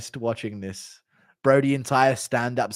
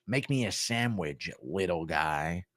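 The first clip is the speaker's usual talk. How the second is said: Jesse on Fire impression; 8 semitones lower, 10 dB quieter, slower than usual. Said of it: "Impression of Jesse on Fire stand-up".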